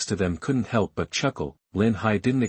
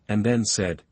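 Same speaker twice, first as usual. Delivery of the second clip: usual speaking voice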